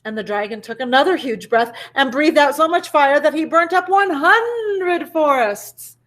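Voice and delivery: ordinary delivery, nothing marked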